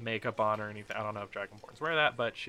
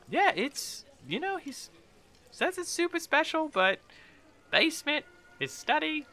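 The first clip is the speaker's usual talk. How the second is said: high, halting character voice; gossiping villager; 5 dB louder than usual. Acted. Villager guessing where the treasure is, high voice. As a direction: pitch it well up, guessing between pauses.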